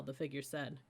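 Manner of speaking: ordinary delivery, nothing marked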